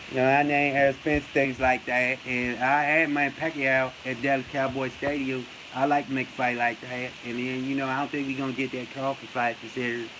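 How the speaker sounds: low, fading mumble in imitation; Errol Spence. Mocking a boxer's rambling interview, low quiet impression. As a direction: drone softly and low like the mocked boxer. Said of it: "he talk like a silent assassin".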